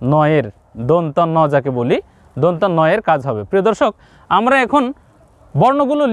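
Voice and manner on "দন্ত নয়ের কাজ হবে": ordinary talk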